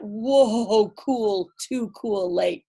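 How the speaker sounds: ordinary delivery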